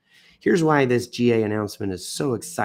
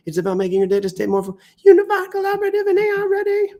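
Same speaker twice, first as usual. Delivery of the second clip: hammed-up hype; 5 dB louder, faster than usual. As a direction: pitch climbs way up, louder and fast. Reading news with rising hype.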